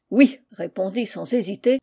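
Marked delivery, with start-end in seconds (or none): 0.1-0.4 loud, resounding yes full of anger; ringing angry yes. Acted. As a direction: bark the yes in fury. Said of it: "d'une voix retentissante et vibrante de colère". (oui retentissant et furieux)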